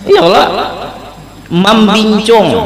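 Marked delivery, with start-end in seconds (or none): 1.5-2.6 "Membincung" read out slowly and higher; slow, stretched reading of "Membincung"; showing how it's read. Shown as a demonstration. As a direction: sound out "Membincung" in long, drawn syllables. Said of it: "Nah, bacanya membincung".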